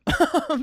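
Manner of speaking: a sudden high laugh at herself; burst of laughter. Real, not acted. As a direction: laugh out loud, high and bright. Laughs at own retold reply.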